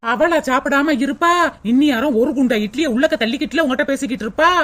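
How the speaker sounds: mocking man. Husband scoffing at the mother's fuss, low sarcastic.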